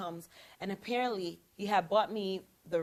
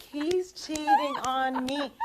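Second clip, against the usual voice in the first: slow, high gasp of suspicion; 8 semitones higher, 6 dB louder, much slower than usual. Retold or imitated. Seeing her boyfriend grab his phone, a slow high gasp of suspicion. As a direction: gasp it like a sudden dark realization. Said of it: "I'm like".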